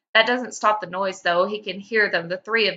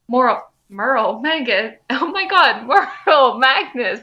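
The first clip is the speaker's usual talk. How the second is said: laughing flub; 4 semitones higher, 6 dB louder than usual. Real, not acted. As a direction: giggly stumble over names. Narrator fumbling names, laughing.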